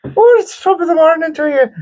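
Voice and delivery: very high, sing-song stage Irish; hammed-up squeaky Irish leprechaun parody; cartoon Irish accent. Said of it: "You became Irish".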